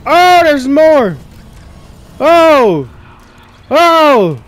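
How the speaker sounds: loud, drawn-out cries of dismay; real alarmed outburst; alarmed shouting. Real, not acted. More enemies appear in game.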